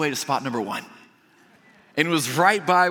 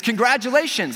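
higher-pitched cheery announcement; retold congratulations; 7 semitones higher, 4 dB louder than usual. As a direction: play an attendant congratulating a winner.

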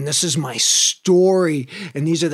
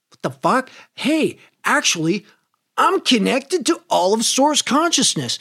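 sudden realisation; 7 semitones higher than usual. Retold or imitated.

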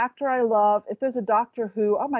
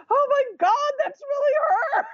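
imitated high-pitched fan freak-out, far above usual pitch; starstruck fangirl squeal. Imitating a partner meeting a TV star, starstruck squeal. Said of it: "he was like".

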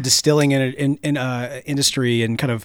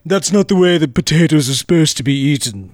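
snobbish caveman voice. Retold or imitated. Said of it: "the very snobbish caveman voice".

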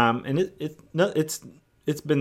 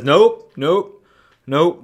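interrupting nope; 3 semitones higher, 7 dB louder, much slower than usual. Retold or imitated. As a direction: cut in on Jesus with a sharp nope. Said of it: "as if he's interrupting Jesus".

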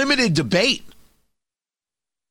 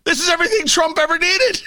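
excited; 10 semitones higher, faster than usual. Retold or imitated. Excited mock urging.

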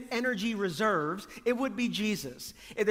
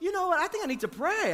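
high-pitched imitation of someone at wits' end; hammed-up high voice, suddenly deciding; mock frazzled; 10 semitones higher, faster than usual. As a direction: act out a frazzled sudden realization. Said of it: "you're screaming at the kids and slamming the doors".

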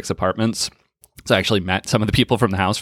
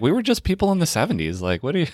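mock seventies voice; 8 semitones higher than usual. Mocking people from the seventies.

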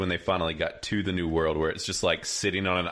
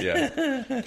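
laughing. Real, not acted. Laughing at the rapper joke.

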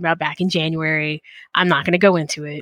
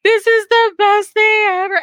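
high, bright burst of delight; overjoyed gush; 11 semitones higher, 4 dB louder than usual. Retold or imitated. Host gushing to listeners about a friend's artwork, overjoyed and high. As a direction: squeal it like the best gift ever. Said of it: "And I'm like".

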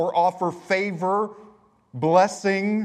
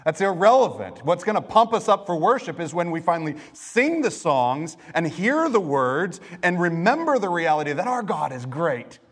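ordinary talk

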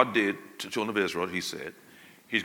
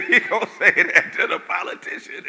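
laughing; 7 semitones higher, 12 dB louder than usual. Real, not acted. Preacher laughing at own remark.

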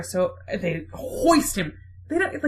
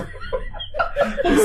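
squealing burst of laughter; cracking up at the punchline; laughing at her own retelling; high laugh. Real, not acted.

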